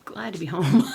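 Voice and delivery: loud cheerful relief at being home; happy exclaim. Real, not acted. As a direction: burst it out happily. Repeated louder and happily.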